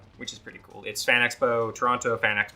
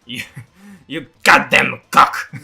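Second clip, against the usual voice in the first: loud, slow mock-French insult; aggressive French accent; 3 semitones higher, 12 dB louder, slower than usual. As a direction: hurl it in cartoon French, drawn out. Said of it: "super aggressive in French".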